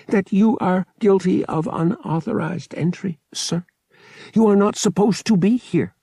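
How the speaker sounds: high, mechanical official voice; formal droid. A narrator's reading. Droid charges pilot with unauthorized entry, mechanical. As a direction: state the violation like a polite robot.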